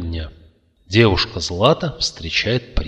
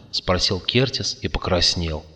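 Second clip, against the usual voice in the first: plain reading voice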